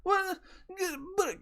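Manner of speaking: high-pitched imitation of bawling children; mock crying kids. Kids walking in on the repo, mock crying. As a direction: whimper wordlessly like upset little kids. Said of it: "they're all fucking crying".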